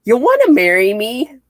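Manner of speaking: loud, high, eager imitation; hammed-up sudden eagerness; mock proposal. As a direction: blurt out a comic proposal. Acts out Carol's sudden proposal.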